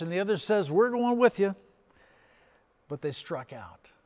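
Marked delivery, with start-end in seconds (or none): 0.7-1.6 eager call. Acted. Disciples voiced, eagerly joining.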